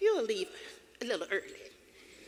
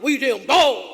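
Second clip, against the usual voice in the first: yelling. Retold or imitated. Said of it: "running out the door yelling".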